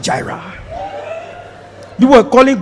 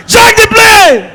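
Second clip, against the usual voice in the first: frantic prayer; 10 semitones higher, 4 dB louder, slower than usual. Retold or imitated.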